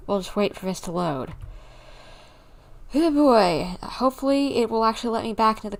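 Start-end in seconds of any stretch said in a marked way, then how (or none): none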